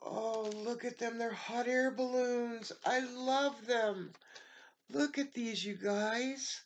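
high, loud excited gushing; squealing delight at the balloons; thrilled squeal. Real, not acted. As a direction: show it off bursting with joy. Showing hot air balloon gifts.

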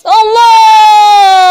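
loud, drawn-out startled yell; startled shout. Real, not acted. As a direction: cry out as she strikes. Caught by Granny.

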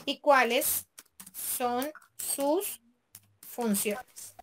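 word by word, with long pauses; spaced-out dictation to yourself; dictating while writing. Real, not acted. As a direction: say each word slowly as you type it. Saying words while typing them.

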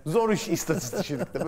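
usual speaking voice

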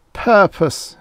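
high, loud groan of complaint. A narrator's reading. She groans at his talk of duty, fed up. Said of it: "she groaned".

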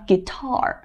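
"Guitar" with the stress on "tar"; stress on second syllable. Shown as a demonstration. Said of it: "后面是不是读得很重这个音".

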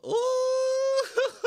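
huge high whooping shout into a laugh; excited whoop. Real, not acted. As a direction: let out a wild squeal, then crack up. Reacts to strong weed.

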